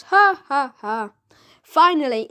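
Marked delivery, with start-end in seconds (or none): 0.0-1.1 loud spelled-out ha ha ha; mock laugh. A narrator's reading. Mister Big laughing at the boy, loud hammed-up laugh. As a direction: ham up a boss's laugh, loud. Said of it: "Then Thumbs".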